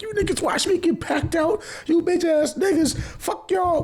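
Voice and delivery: high, furious yell at the homies; betrayed friend lashing out; angry yelling. Retold or imitated. Yelling at friends who watched.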